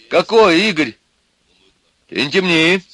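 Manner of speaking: loud, high challenge; loud, thrown. A narrator's reading. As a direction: push it out loudly, caught off guard. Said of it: "громко сказал Костя, он растерялся".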